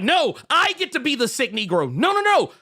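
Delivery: high, insistent bickering; mock squabble. Host imitating two rivals competing for a title, high mock protest. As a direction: protest loudly in a high, hammed-up voice.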